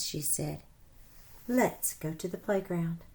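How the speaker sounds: lively read-aloud storytelling voice; animated storybook narration for children; story narration. A narrator's reading. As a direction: read it like a picture book to kids. Reads story aloud to class.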